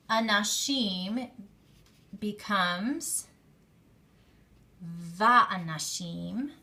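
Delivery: "va'anashim" spoken slowly, syllable by syllable; pronunciation demo. Shown as a demonstration. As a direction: drag out "va'anashim" for students to hear. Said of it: "men becomes and men".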